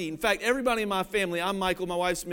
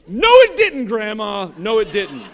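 louder, laughing retort to grandma; loud protest; 9 dB louder than usual. Retold or imitated. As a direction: shout it back at her, half laughing. Arguing back with grandma.

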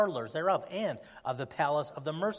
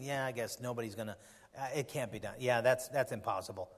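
discouraged doubter; 5 semitones lower, 4 dB quieter than usual. Acted. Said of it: "You can kinda start thinking".

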